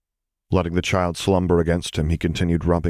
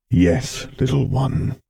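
Vader agreeing; 8 semitones higher, slower than usual. A narrator's reading. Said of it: "he agreed".

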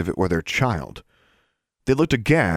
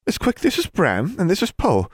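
higher-pitched lady librarian voice; prim librarian; 12 semitones higher than usual. A narrator's reading. Librarian assigning a student's detention work, prim high voice. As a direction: give orders as a librarian.

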